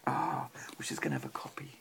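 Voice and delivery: whispered aside, almost no voice. Real, not acted. Parent whispers to child mid-story, soft whisper. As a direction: lean in and whisper it.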